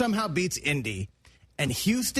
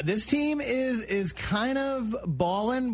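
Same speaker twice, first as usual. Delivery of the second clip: pitched-up playful voice; high register voice; 6 semitones higher, slower than usual. Acted. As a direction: lift the voice high and slow down. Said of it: "what's your high register take".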